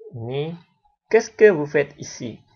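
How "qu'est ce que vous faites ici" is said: "Qu'est-ce que vous faites ici" slowly, without rising at "ici"; no question intonation. Shown as a demonstration. Teacher shows est-ce que alone makes a question, no rising intonation. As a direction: keep "ici" down, like a statement. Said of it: "walaupun intonasinya nggak ada".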